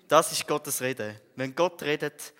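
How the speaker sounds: loud and slow, hammering each word home; emphatic proclamation. Real, not acted. Preacher telling the congregation God spoke, loud and slow. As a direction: preach it out with full conviction. Testimony, proclaiming God's words.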